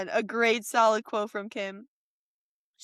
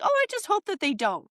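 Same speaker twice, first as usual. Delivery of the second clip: mocking officials; 7 semitones higher, much faster than usual. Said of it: "they're just like".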